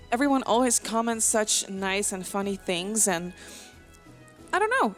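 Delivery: usual speaking voice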